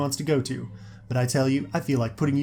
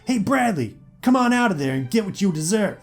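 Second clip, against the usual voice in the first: loud, much higher bully's yell; raised, strained call from outside; angry shout; 9 semitones higher, 5 dB louder than usual. A narrator's reading. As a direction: holler threats through a closed door. Bully yells through pounded door.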